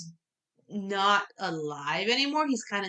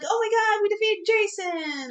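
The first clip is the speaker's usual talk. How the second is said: put-on high-pitched cheering kids; mock cheering; 11 semitones higher than usual. Imitating the kids cheering Jason's defeat, high mock cheer. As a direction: cheer it like kids celebrating a win. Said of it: "the kids are cheering".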